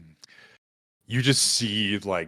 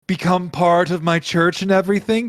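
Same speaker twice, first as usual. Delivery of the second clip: higher, louder casual pitch; chummy god of chaos; 9 semitones higher, 7 dB louder than usual. Acted. God inviting guests into his church, chummy and loud. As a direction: recruit them like an easygoing, upbeat god. Game master voices Jin.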